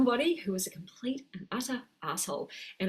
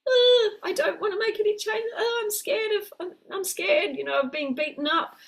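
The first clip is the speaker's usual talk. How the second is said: much higher, tearful frightened little voice; scared child; 10 semitones higher, 4 dB louder than usual. Acted. Inner child afraid of being beaten, high tearful whimper. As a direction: whimper like a frightened five-year-old. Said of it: "our little child self gets scared".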